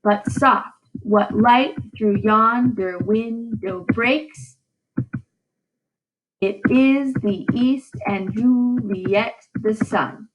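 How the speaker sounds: slow, every second beat stressed; teacher reads Romeo's lines to the class in slow da-dum meter; reading in iambic pentameter. Shown as a demonstration. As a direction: recite with a heartbeat rhythm. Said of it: "here would be an example of iambic pentameter".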